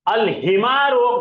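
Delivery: अल हिमारो slowly, ल kept clear; slow Arabic reading. Shown as a demonstration. Teacher to class, slowly reading the moon-letter word. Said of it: "नहीं हो रहा।".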